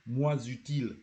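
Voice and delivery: MOINS runs into UTILE with a z; liaison. Shown as a demonstration. Teacher has learners repeat MOINS UTILE, liaison. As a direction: join MOINS UTILE by a linking z. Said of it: "Repeat after me".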